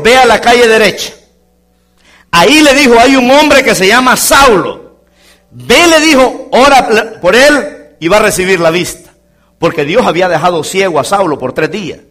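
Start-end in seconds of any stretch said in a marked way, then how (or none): 0.0-9.1 high, loud voice of God; God commanding. Acted. God sends Ananias to Saul in a loud commanding voice. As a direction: announce the command from heaven.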